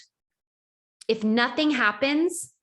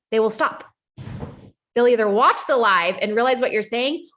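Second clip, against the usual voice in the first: normal talking voice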